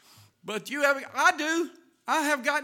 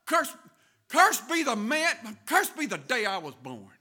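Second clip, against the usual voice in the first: cursing his birth; 7 semitones higher, 12 dB louder than usual. Retold or imitated. Jeremiah cursing the day he was born, loud. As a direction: spit out each curse. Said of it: "He says".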